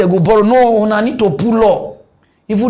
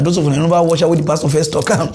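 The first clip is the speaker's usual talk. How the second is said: ordinary talk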